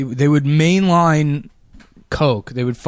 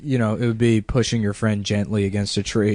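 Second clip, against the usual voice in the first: usual speaking voice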